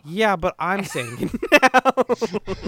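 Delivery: slow, laughing insistence; slow amused retort. Retold or imitated. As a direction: drag it out with a laugh. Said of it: "and he's like".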